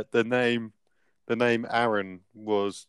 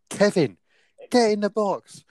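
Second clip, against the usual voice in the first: a hint of a barking coach, pitched up; mock coach shout; 10 semitones higher than usual. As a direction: lightly imitate the coach ordering a player forward. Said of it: "Barry's just going".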